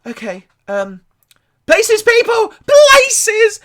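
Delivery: very high, very loud yell; frantic shout. Acted. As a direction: bellow the stage call in panic. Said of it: "she's like".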